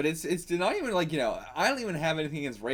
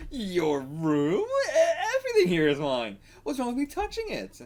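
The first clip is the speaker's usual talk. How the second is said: put-on higher, loud, snooty girl voice; bratty stepsister; 10 semitones higher, 4 dB louder than usual. Stepsister caught in the room, snooty brat voice. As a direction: toss it off like a spoiled brat.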